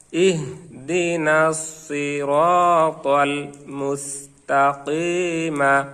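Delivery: slow chanted verse, মুস্তাকিমা ending on an open ma; slow joined recitation. Shown as a demonstration. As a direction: recite it joined, no stop on the final syllable. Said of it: "এরপরে আমরা মিলিয়ে নেব". (মিলিয়ে ধীরে তেলাওয়াত, শেষে মুস্তাকিমা)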